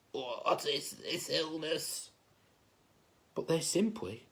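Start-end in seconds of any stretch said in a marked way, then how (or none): 0.1-2.1 slow, soft, croaky sick king; croaking. Acted. Sick king asking doctors about his illness, croaking. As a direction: croak it out weakly. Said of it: "He croaked".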